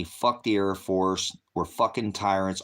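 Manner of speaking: usual speaking voice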